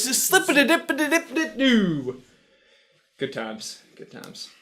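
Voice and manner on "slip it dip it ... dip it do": high-pitched nonsense patter; sing-song babble dropping at the end; playful tongue-tangle. Real, not acted. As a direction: turn the stumble into a silly high riff. Mocking own stumble with nonsense patter.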